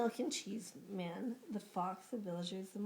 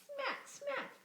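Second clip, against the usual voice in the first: lip smacking. Acted. Fox licking chops.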